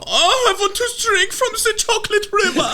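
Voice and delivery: hammed-up high, loud German-accented squeal; greedy goofy cartoon German boy, way up high; Augustus Gloop. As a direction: shriek it in a silly German falsetto. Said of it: "very offensive Augustus Gloop impression".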